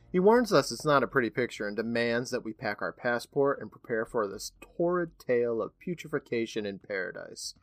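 ordinary talk, nothing marked

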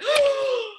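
high sharp intake of breath; sharp gasp. Retold or imitated. Medium gasps at the spirit's message, high and sharp. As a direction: gasp in shock at the revelation. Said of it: "And I'm like".

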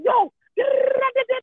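high-pitched mock angry babble; mad ranting. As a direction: rattle off a worked-up rant in a high voice. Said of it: "I'd be so mad at him".

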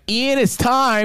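high, sing-song; hammed-up mother voice; mock mom. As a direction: announce it like a cheery mom. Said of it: "she's like".

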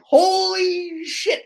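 slow, high, drawn-out amazement; stunned. Retold or imitated. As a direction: let the shock stretch the words out. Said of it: "And I'm like".

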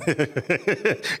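an embarrassed little laugh; rising laugh. Real, not acted. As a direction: laugh at yourself for freezing. Laughs at failed countdown.

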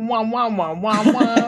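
muffled wah wah talk; wah-wah like an adult in Peanuts; Charlie Brown teacher. Retold or imitated. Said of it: "Charlie Brown teacher".

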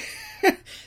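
very high, loud squealing laugh; burst of laughter. Real, not acted. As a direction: let a real laugh escape way up high. Laughing at herself.

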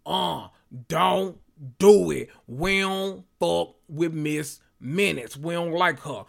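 slow, drawn-out word-by-word plea; hammed-up slow, emphatic objection; mock Loki warning. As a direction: warn them off haltingly, spacing each word. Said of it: "Loki was like".

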